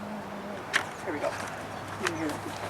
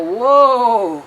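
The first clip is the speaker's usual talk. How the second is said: louder cowboy call; yelling whoa; 2 semitones higher, 10 dB louder than usual. Acted. Cowboy hooking longhorns, calling out loud. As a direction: holler it out to the herd. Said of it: "and yell".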